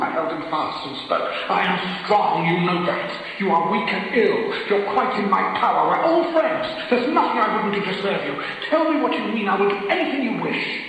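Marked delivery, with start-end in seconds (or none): none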